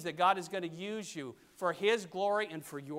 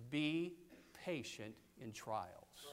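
slow, soft, low quote; hushed, unhurried advice; gentle counsel; 5 semitones lower, 8 dB quieter, much slower than usual. Retold or imitated. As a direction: counsel patience quietly, word by word. Apostle's counsel, voiced slowly.

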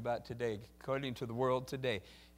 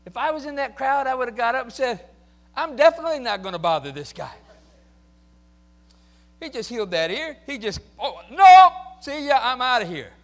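loud, higher, excited bystander; excited fleeing; 11 semitones higher, 19 dB louder than usual. Retold or imitated. Preacher imagining himself fleeing the arrest, loud and excited. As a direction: panic and bolt, playfully.

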